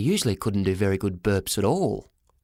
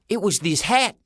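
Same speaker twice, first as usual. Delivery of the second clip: put-on high-pitched kid making excuses; high, worried young lad; defensive boy; 9 semitones higher than usual. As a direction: protest in a high voice. Boy blames the hat.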